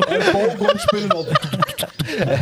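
words squeezed out high through real laughter; laughing. Real, not acted. Friend joking about the club scene, laughing hard.

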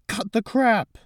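high, loud angry yell; furious shout. Acted. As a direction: bellow it and hurl the glass. Harold shouts and throws glass.

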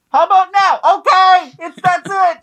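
high, loud calls between floors; yelling across the room. Retold or imitated. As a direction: holler back and forth.

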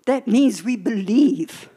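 ordinary talk, nothing marked

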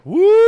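very high, loud woo; celebratory whoop. Retold or imitated. Guest raiding the free minibar, celebratory whoop. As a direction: let out a shrill party whoop.

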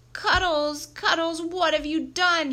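high, loud cry of reproach; dismayed scolding. A narrator's reading. Principal finding his dog at the scene, loud scolding.

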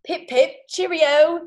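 high, loud hammed-up posh British; a put-on toff saying cheerio; mock British accent.

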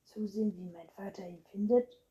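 loud, protesting rhetorical question; outraged and forceful; indignant. A narrator's reading. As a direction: throw it back as a challenge. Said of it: "rief Anton empört".

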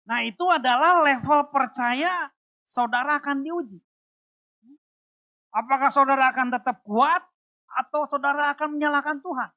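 fiery sermon question; rousing preaching. Acted. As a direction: press the church with the question. Preaching challenge to congregation.

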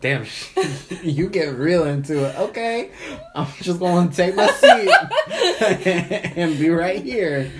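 loud laughter through the words; laughing. Real, not acted. Laughing at friend's passion.

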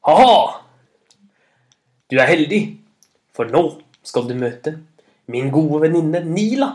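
loud, boastful laugh-and-call; Thor's booming, cocky reply; shouting back. A narrator's reading. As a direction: call back heartily, building to a proud announcement. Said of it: "Og Thor ropte tilbake igjen".

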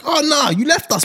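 high-pitched betrayed wail; hammed-up, swooping high protest; mock outrage. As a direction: act out exaggerated playground betrayal. Acting out classmates feeling betrayed.

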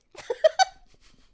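shrieking high laughter; loud burst of laughter. Real, not acted. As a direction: explode into laughter. Laughs at skinny jeans.

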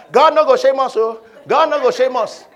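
loud, high shouted declaration; fiery preacher shout. Shown as a demonstration. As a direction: shout it out with full force. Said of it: "it's not enough to shout".

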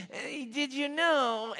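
high, drawn-out aunt voice; Aunt Peggy. Retold or imitated. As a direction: stretch it out slowly in a high put-on voice. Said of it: "Peggy used to talk like this".

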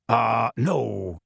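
mildly dismissive denial. A narrator's reading. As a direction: brush the question aside, drawn out. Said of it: "shaking his head dismissively".

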